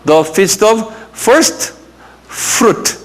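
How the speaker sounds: "the feast of first fruit" spoken slowly, "fruit" breathed out; slow model phrase. Shown as a demonstration. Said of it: "한번 발음해보세요".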